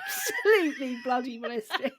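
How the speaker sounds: laughing through the words; helpless laughter. Real, not acted. Relishing the phrase with co-host, laughing helplessly. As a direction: squeeze it out while cracking up.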